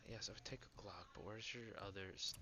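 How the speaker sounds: soft unvoiced whisper; whispered aside. Real, not acted. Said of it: "whispering in game".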